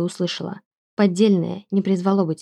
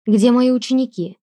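loud, alarmed question; urgent call; 5 semitones higher, 6 dB louder than usual. Acted. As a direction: call out anxiously for them. Heroine wakes, asks for students.

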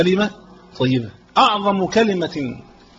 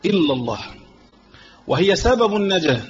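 ordinary delivery

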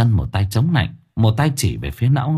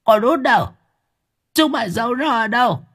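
shrill, high ghoul voice; frantic hag; 14 semitones higher than usual. Acted. Grandmother hunting for her granddaughter, shrill and frantic. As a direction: demand it wildly.

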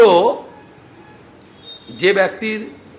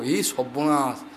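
despair; 5 semitones lower, 8 dB quieter, slower than usual. Retold or imitated. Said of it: "বলে".

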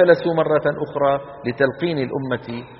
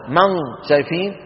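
normal talking voice

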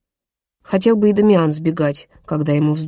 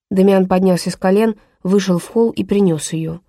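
usual speaking voice

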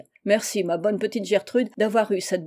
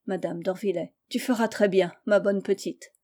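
ordinary delivery

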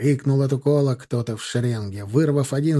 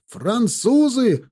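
high, drawn-out warning shout; barked alarm; 9 semitones higher, 3 dB louder, much slower than usual. A narrator's reading. Lookout spotting enemy riders, barked alarm. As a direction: raise the alarm with a stretched cry. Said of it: "рявкнул он".

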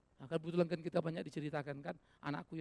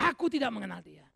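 louder, forceful declaration; loud denial; 3 semitones higher, 10 dB louder than usual. Acted. Peter denying his master, now declared loudly. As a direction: throw the denial out boldly.

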